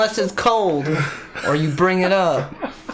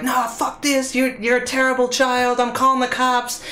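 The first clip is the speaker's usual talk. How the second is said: angry mom; 7 semitones higher than usual. Retold or imitated. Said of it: "she was like".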